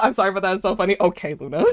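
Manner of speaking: ordinary delivery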